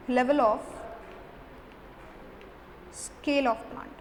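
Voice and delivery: slow, word-by-word dictation pace; slow note dictation. Acted. Teacher dictating notes to students, slow and deliberate. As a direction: spell it out so students can write it down.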